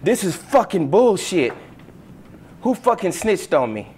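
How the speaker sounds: loud, high-pitched angry outburst, acted; upset with attitude. Student storms at whoever snitched on him, upset with attitude. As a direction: act out an indignant kid raising his voice. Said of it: "with an attitude and upset".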